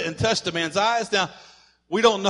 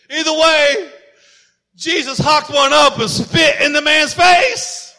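preaching shout; 6 semitones higher, 6 dB louder than usual. Real, not acted. Preacher hammering the point home.

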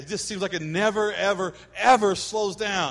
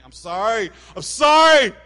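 hammed-up husband yelling sorry at the top of his voice; a mock yell of sorry, much higher and louder; mock apologetic shout; 4 semitones higher, 10 dB louder than usual. The husband shouts his apology to his wife, hammed-up. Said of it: "guys are like".

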